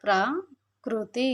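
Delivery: ప్రకృతి drawn out very slowly, syllable by syllable; slow demo pronunciation. Shown as a demonstration. Teacher modelling the word for children, very slow. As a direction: spell out ప్రకృతి slowly for the class. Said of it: "పలికేటప్పుడు".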